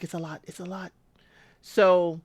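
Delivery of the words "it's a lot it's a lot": hushed, much softer imitating Ashley; quiet mock daughter; soft imitation. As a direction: say it softly, a little overwhelmed. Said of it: "She's like".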